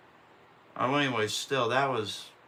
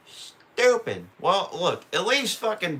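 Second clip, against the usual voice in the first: "Stupid" blurted higher and louder; angry "Stupid" yelled at the screen; frustrated outburst; 7 semitones higher, 4 dB louder than usual. Real, not acted. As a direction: spit out "Stupid" in a sudden burst of frustration. Fuming after the race ends.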